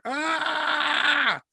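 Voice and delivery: short high shriek out of nowhere; jump-scare scream; sudden scream. Retold or imitated. Masked girl suddenly screams.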